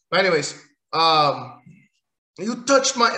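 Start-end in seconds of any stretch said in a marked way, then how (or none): 2.4-3.2 Goldberg impression. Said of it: "in the words of Goldberg".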